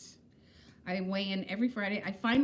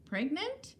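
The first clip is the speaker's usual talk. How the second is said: rising, puzzled echo of a word; surprised question; 7 semitones higher, 3 dB quieter than usual. Real, not acted. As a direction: ask it back, surprised. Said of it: "I don't know".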